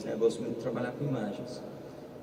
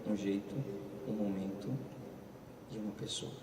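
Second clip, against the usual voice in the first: fading to hushed; 4 semitones lower, 11 dB quieter than usual. Acted. Preacher telling listeners the right moment, fading hushed. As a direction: lower and much softer, fading to near nothing.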